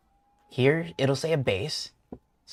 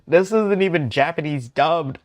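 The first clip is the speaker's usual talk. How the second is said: loud, high disbelief; amazed exclamation; 7 semitones higher, 11 dB louder than usual. Real, not acted. Streamer amazed at the game's dialogue, loud high exclamation. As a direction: blurt it out loud, straight from a laugh.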